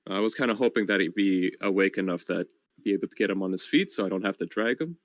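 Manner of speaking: normal talking voice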